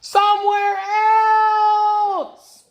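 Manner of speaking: high, loud wail; desperate cry. Acted. Elephant wails to Piggie that the birds must go, high and loud. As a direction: beg for them gone, high and loud.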